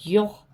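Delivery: یخ with a rasping خ from the throat; clear kha sound. Shown as a demonstration. Teacher isolating the kha for students. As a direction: scrape the خ in یخ. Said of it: "خا کی آواز نکالیں گے".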